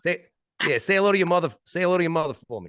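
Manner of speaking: high, loud Boston accent impression; Mark Wahlberg. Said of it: "Boston accent is just Mark Wahlberg".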